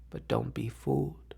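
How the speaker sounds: softly spoken, low falling plea; quiet warning. Acted. Soft first plea in recited poem.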